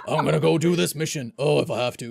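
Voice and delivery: put-on gruff, grumbling hero. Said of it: "he has this gruff voice".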